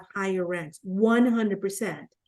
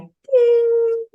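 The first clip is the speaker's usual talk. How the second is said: a high, louder bell ding; ping it out like a chime; mock ding; 14 semitones higher, 6 dB louder than usual. Her mind tuning out the partner, high ding. Everything after sounded like noise.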